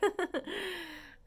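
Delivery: high bright giggle; laugh. Real, not acted. Laughing at her own chant, high giggle. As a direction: giggle at yourself.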